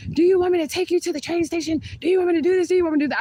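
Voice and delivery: high, loud imitation of an angry date; mock yell.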